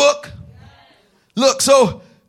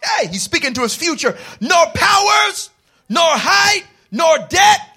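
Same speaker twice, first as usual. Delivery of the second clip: full-force preaching; 5 semitones higher, 3 dB louder than usual. Acted. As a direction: proclaim every "nor" like a rallying cry.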